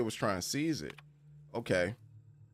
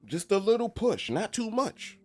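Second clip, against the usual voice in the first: much higher, louder coaxing voice; 13 semitones higher, 4 dB louder than usual. Pretend. High coaxing while nudging the character along. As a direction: coax it up high and gentle.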